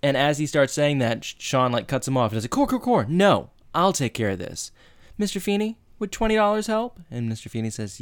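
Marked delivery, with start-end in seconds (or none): 2.5-7.0 high, cocky interruption; smug take-charge. Retold or imitated. Sean cuts off Corey to help the teacher, cocky. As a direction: swagger in like you've got this. Sean taking over, showing off.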